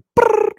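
high-pitched excited squeal. Retold or imitated. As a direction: squeal like a crowd of kids. High squeal imitating crowd.

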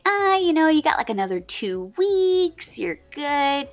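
breezy, high, casual dismissal; nonchalant. Retold or imitated. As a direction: shrug it off lightly. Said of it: "so nonchalant".

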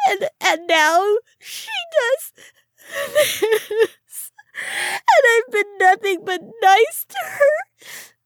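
high, loud, hysterical crying through the words; sobbing. A narrator's reading. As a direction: drag each word out through sobs. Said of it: "she began to sob".

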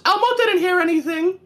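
very high sulky puppet voice; high squeak, pointedly ignoring her; Elmo voice. Retold or imitated. Friend retelling Elmo ignoring Zoe, very high puppet voice. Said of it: "He goes".

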